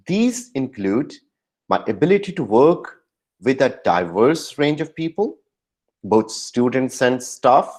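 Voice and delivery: slow, with a pause after These include. Shown as a demonstration. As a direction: hold a beat after include, then go on. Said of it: "you need to give a pause". (include के बाद रुककर, धीरे बोलें)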